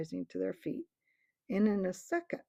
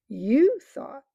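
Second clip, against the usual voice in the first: loud, raised mocking repeat; scornful echo; 6 semitones higher, 11 dB louder than usual. A narrator's reading. The ape mocking the bear, scornful. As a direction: throw his words back with scorn. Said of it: "repeated the ape".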